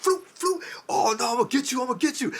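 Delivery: high, fast cocky taunt; excited fighter voice. Acted. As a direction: taunt like a rattled boxer. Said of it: "and dude was like".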